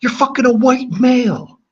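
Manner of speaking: very high, loud put-on voice; mock outraged squeal. High-voiced meme outburst at chat.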